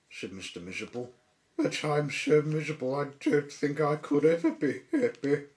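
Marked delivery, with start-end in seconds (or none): 1.6-5.5 higher, louder, put-on glum voice; added miserably. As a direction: whine it like a hopeless sad sack. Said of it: "he added miserably".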